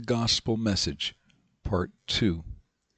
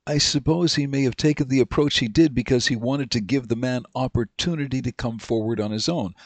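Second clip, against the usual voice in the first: ordinary talk, nothing marked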